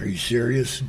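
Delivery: usual speaking voice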